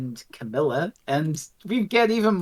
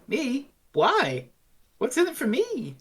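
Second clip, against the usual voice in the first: high-pitched, questioning comeback; defiant young Adric; 9 semitones higher than usual. Acted. Adric answers Alcon's offer with fighting spirit, defiant. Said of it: "And Adric's just like".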